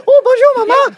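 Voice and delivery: squealing high, loud mock French child; French-speaking little boy. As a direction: play a giggly tot babbling in French. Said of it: "just knows French".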